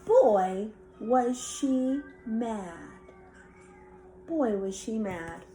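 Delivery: usual speaking voice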